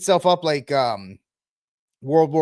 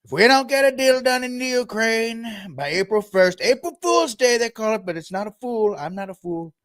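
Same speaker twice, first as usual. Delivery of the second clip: higher, put-on blustery Trump voice; Trump impression; 6 semitones higher than usual. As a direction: hams up Trump floating a threat. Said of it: "he'll float it out".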